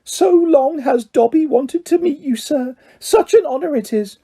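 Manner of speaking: high, fluttering elf voice; a small creature overjoyed to meet his hero; eager house elf. A narrator's reading. Elf meeting Harry Potter at last, high and eager.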